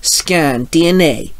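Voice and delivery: slow, mildly projected command; drawn-out morphing call. A narrator's reading. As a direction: call it out as an activation phrase. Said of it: "Timothy called out".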